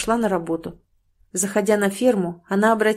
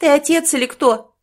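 higher, louder demand; indignant reproach; 7 semitones higher, 6 dB louder than usual. A narrator's reading. Wife scolding her husband at the door, indignant and loud. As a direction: shame him into staying.

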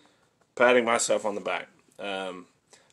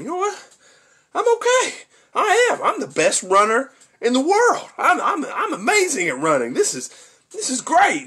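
much higher, squeaky, puffed-up self-talk; high squeaky inner voice; 14 semitones higher, 3 dB louder than usual. Retold or imitated. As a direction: squeak it out like a proud kid. Dad's inner voice while running.

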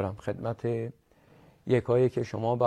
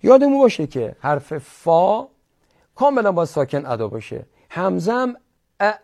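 ordinary delivery

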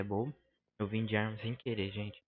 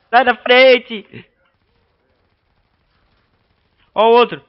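frantic shout; 13 semitones higher, 20 dB louder than usual. Real, not acted. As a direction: bark it out in a panic. Panicked gaming shouts. (gritando desesperado)

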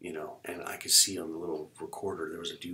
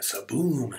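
punched, drawn-out sound effect, louder; imitated slashing sound; 5 semitones higher, 8 dB louder than usual. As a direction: hit it like a sudden blow.

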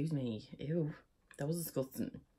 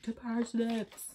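slow, higher hammed-up toast; slow mock toast, much higher; repeating the toast; 8 semitones higher, much slower than usual. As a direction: savour the punchline. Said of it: "I was just like".

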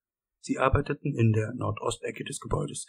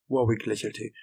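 plain reading voice

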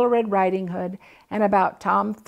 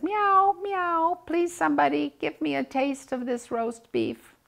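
high, pleading kitten voice; meowing kitten asking for roast; begging kitten; 7 semitones higher than usual. A narrator's reading. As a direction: beg for a taste like a little cat. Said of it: "She looks at it and says".

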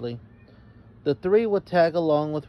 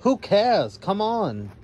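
demanding; 7 semitones higher, 4 dB louder, slower than usual. A narrator's reading. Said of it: "demanded Roxanne".

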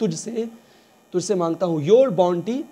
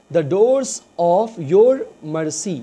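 very slow, each word drawn out; slow dictation; 5 dB louder, much slower than usual. Shown as a demonstration. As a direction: spell it out slowly for learners. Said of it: "slow teaching read". (बहुत धीरे, रुक-रुक कर)

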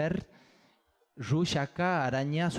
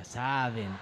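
usual speaking voice

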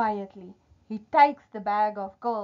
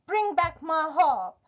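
raised voice, moderate storybook shout; giant shouting; 6 semitones higher, 3 dB louder than usual. A narrator's reading. Giant wants his stolen harp back, shouting. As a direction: demand it back in a higher voice. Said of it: "shouts the giant".

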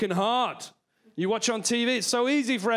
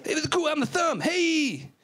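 high-pitched cocky cool guy voice; cool thumb character; 7 semitones higher than usual. Acted. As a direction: play a thumb showing off, pitched well up. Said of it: "Like your thumb".

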